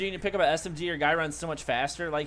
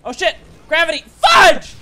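high, loud outburst building to a yell; frustrated gamer's yell; exasperated shouting; 10 semitones higher, 17 dB louder than usual. Real, not acted. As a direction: shout it out, climbing to a screamed last word. Reacting to in-game death.

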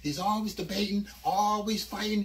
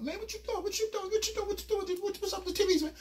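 much higher, frantic repeated question; panicky Zeus; 10 semitones higher, faster than usual. Retold or imitated. Zeus panicking at the narrator. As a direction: squeak it out like he's freaking. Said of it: "Zeus like".

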